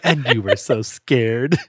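slow, lightly teasing puppet voice; Grover. Acted. Grover at the very end, lightly teasing the reader. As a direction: draw it out as a puppet's gentle mock tease. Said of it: "That's a good Grover voice".

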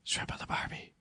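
Shrimp on the Barbie in a voiceless whisper; whispered Australian line to hide the accent. Shown as a demonstration. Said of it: "it's quiet enough".

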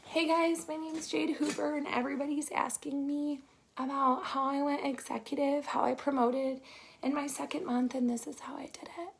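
soft, breathy intro to a first live; mock her own timid first video, barely above a whisper; sleepy, muted self-parody of a bad first live; lying down mumble. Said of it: "I was laying down like this and I was like".

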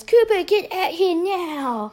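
Ethan rushes in calling Cooper, high mock yell; urgent call. Brother summoning Cooper outside, urgent drawn-out mock yell. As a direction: imitate a brother's urgent high, slow holler. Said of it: "comes rushing in and says".

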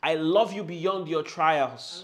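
loud and slow, drawn out; slow, bold affirmation; loud slow declaration. Shown as a demonstration. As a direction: proclaim it to yourself. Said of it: "talk to yourself again and say".

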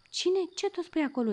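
high, loud startled question. Acted. Dog jolted awake by the kitten, high startled cry. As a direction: jump up and ask in alarm.

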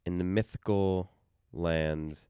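low, calm storyteller voice; epic fantasy narrator. Pretend. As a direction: tell it like an ancient legend. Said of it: "my voice just went down ... super calm".

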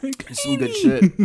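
high slow laughing praise. Real, not acted. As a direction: squeak it out laughing. Laughing about beer salt.

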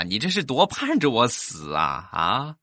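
ordinary talk, nothing marked

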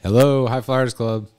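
slow, drawn-out radio host greeting; radio contest promo. Pretend. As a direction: announce it like a DJ on air. Hosts a radio call-in contest.

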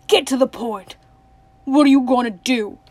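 loud, impatient outburst; crying out. A narrator's reading. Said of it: "cried Tuck".

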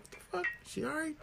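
surprised. Retold or imitated. Confused quote of himself.

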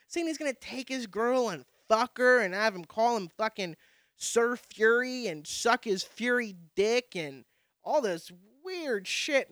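ordinary talk, nothing marked

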